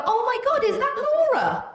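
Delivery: very high, loud fake squeal; mock surprise. Teenager pretending to spot Laura behind her, mock-excited squeal. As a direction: squeal as if spotting a friend, hammed-up.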